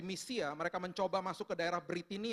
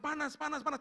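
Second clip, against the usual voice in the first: high, rapid repeated cry; alarmed retold yelp; 9 semitones higher than usual. Wife feeling heat leave through her hands, yelping. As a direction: imitate someone yelping at sudden heat.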